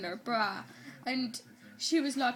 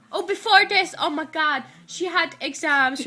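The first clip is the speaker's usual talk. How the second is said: loud, higher burst of remembering; excited recollection; 4 semitones higher, 8 dB louder than usual. Real, not acted. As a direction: blurt it out as it comes back to you. Suddenly remembers a skipped plot point.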